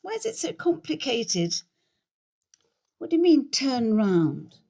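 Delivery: loud, high-pitched protest; flustered irritation. Acted. Flustered dispute over operating the iPad.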